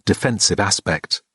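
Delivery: plain reading voice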